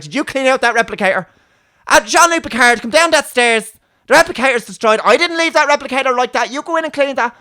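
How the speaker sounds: very high, loud Dublin nagging; strong Dublin accent. Retold or imitated. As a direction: holler down the stairs in broad Dublin. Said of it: "a strong Dublin accent".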